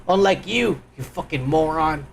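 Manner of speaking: ordinary delivery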